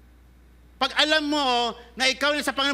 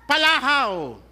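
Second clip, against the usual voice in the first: loud wail; 3 semitones higher, 5 dB louder, slower than usual. Shown as a demonstration. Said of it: "Kung iyakan rin lang".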